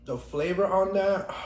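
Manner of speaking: high, loud and slow, relishing; drawn out in delight; savoring. Real, not acted. Savoring noodles.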